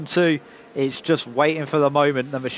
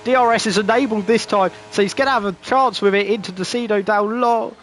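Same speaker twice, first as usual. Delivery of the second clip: higher, lively live race call; motorsport commentator; 5 semitones higher than usual. Acted.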